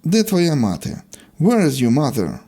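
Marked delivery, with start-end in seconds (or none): none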